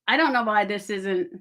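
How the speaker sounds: usual speaking voice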